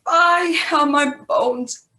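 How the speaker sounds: milk every ache for sympathy; hammed-up weary grumble; mock aching complaint. Nurse complains of aching bones.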